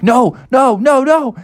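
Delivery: high, loud repeated refusal; high cartoon protest; panicked park ranger. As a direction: fire out the words in rising alarm. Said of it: "He was like".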